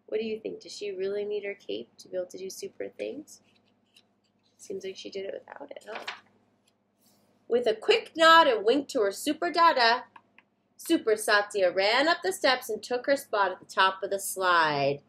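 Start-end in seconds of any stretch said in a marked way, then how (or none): none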